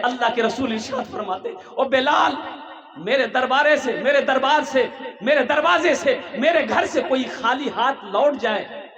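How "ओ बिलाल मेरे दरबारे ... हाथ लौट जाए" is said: loud, drawn-out call, pitched up; impassioned calling out. Acted. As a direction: proclaim it urgently to a servant. Prophet voiced calling to Bilal.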